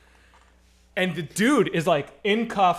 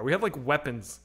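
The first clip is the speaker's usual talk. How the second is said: ordinary delivery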